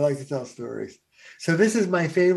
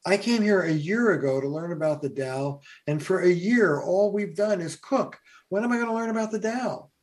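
put-on a fed-up kid demanding answers, rising in complaint; frustrated outburst; 7 semitones higher than usual. Young kid throws down his cooking gear, frustrated rising outburst. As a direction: pitch climbing higher and higher, faster by the end.

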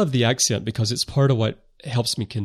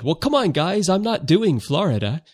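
quoted protest; 9 semitones higher, 3 dB louder than usual. Retold or imitated. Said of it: "I said".

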